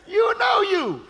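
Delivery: high, shouted declaration; preaching shout. Real, not acted. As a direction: call it out to the congregation.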